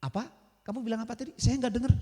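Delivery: mock puzzled, pretending not to catch it; feigning deafness. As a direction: softly repeat as if hard of hearing. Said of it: "Pura-pura budeg".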